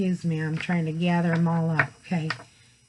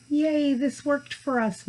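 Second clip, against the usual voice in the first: high, slow happy exclamation; excited cheer; 7 semitones higher than usual. Retold or imitated. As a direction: cheer it like a small victory. Quoting a happy success moment.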